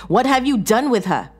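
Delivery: high, loud accusing question; demanding. Acted. Bound man confronting Sarah about Leslie, demanding. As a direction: raise the voice and demand answers.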